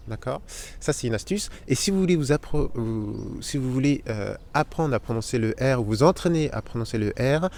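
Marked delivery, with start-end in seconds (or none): none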